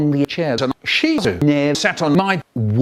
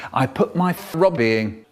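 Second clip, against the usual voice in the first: ordinary talk, nothing marked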